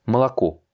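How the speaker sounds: "Malako" with unstressed O's read as A; Russian reading. Shown as a demonstration. Teacher reads the first word aloud, Russian pronunciation. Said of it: "on va les lire".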